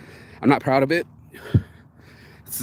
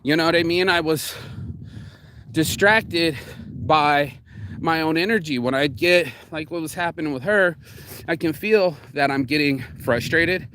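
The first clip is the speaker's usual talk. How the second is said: usual speaking voice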